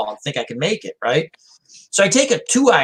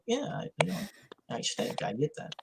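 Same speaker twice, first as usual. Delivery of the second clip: sheepish mumble; 15 dB quieter than usual. Retold or imitated. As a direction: drop to a near whisper, half unvoiced. Sheepishly admitting he stinks to dad.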